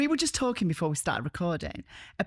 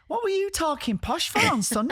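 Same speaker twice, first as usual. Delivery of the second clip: a hint of broad Barnsley, pitched higher; mock Barnsley listener; 10 semitones higher than usual. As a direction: tease like a cheeky local listener. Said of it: "listeners ... were going".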